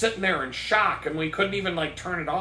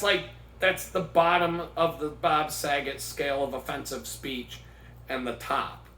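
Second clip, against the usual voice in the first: ordinary talk, nothing marked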